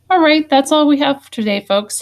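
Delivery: high, loud goodbye to the class; cheerful sign-off. Real, not acted. Teacher signing off the lesson.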